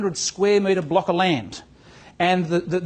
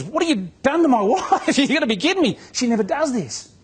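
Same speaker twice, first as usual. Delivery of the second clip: incredulous husband; 7 semitones higher than usual. Retold or imitated. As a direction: play the baffled husband, stunned and amused. Husband's shocked question, retold.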